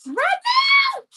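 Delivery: high, loud burst of excitement; excited shout. Real, not acted. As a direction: shout it out with glee. Excited shout about signing.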